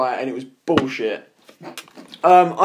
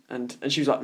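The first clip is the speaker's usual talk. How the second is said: usual speaking voice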